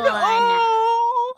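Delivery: high yelp of delight; surprised cry. Real, not acted. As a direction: let out a squeal of surprise.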